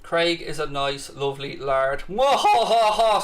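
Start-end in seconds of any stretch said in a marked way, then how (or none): 2.1-3.2 slow sneering villain laugh; evil cackle. Pretend. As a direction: cackle like a panto baddie. Mock villain laugh at a viewer.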